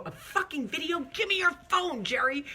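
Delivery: high-pitched retold exasperation; alarmed demand. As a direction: act out a flustered plea to hand it over. Retold panicked demand for phone.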